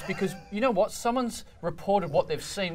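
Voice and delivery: normal talking voice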